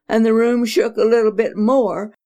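usual speaking voice

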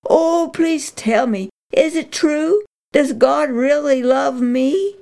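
high, pleading eagerness; eager pleading. Acted. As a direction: beg to hear it is true. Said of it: "she cried".

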